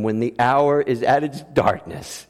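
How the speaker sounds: ordinary talk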